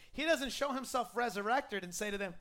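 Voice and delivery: ordinary talk, nothing marked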